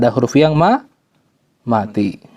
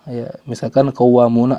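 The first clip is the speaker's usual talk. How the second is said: ordinary talk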